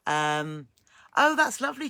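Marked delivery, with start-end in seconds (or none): 1.2-1.9 delighted exclamation. Real, not acted. Greeting viewer's sewing with delight.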